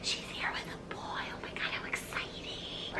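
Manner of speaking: excited gossip whisper; hushed teacher gossip, unvoiced. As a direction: whisper it like a thrilled teacher spying.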